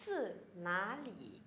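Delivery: slow, careful Mandarin question; slow, syllable by syllable Chinese demo; slow model reading. Shown as a demonstration. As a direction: read it out slowly for students to copy. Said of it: "câu hỏi là".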